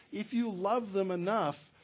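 normal talking voice

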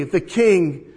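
ordinary talk

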